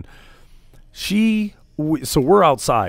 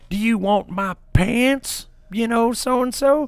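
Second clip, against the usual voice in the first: high, slow sarcastic mom voice; mocking drunk mom; 10 semitones higher, slower than usual. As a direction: imitate the mom sneering at the stepdad. Drunk mom taunting stepdad, retold.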